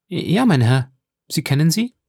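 put-on bright questioning young mother; eager lady. A narrator's reading. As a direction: ask him eagerly. Lady answers count.

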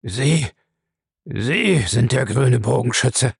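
slow, halting disbelief; Bellamy stammering. A narrator's reading. Cornered man recognising the Green Archer, stammering slowly. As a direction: drag the words out haltingly. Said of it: "stammelte Bellamy abgerissen".

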